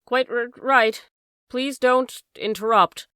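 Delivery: the vicar answers through the door in a strange jerking voice; jerking intonation. A narrator's reading. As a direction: force the words out in uneven jerks. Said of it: "with a curious jerking intonation".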